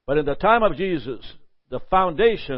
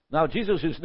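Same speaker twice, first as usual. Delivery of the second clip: usual speaking voice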